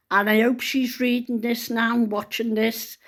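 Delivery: ordinary delivery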